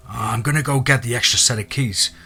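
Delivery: ordinary delivery, nothing marked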